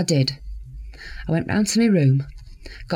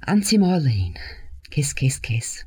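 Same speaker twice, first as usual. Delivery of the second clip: whispered card message, tender. Real, not acted. Marlene reading her niece's card aloud, whispered. Reads child's card softly.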